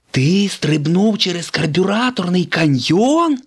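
high, loud incredulous question on «каньйон»; can't believe the word «каньйон»; Lightning doubting the stunt story, disbelieving. Acted. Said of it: "не повірив своїм вухам".

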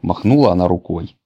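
ordinary delivery, nothing marked

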